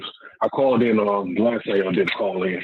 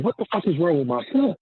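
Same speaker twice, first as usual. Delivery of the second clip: higher-pitched, retold alarm; alarmed disbelief; 7 semitones higher than usual. Father recalling his son's breakdown, alarmed disbelief. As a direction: retell a bewildered, worried reaction.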